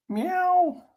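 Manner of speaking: high cooing voice for a kitty; talking to a cat. Pretend. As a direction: coo sweetly at the cat. Greeting a cat on screen.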